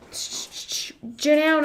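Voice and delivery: drawn-out whisper of Janelle; eerie slow whisper of Janelle; whispered name. Pretend. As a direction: breathe the name Janelle slowly. Calling a name in odd voice.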